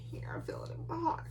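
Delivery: whispered, high, half-crying; fake-sob it into a whisper; mock teary whisper. Mock-emotional reaction to romance.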